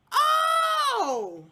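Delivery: loud, high shocked cry of oh; shocked gasp. Real, not acted. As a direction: yelp in shock. Shocked reaction to Nova's insult.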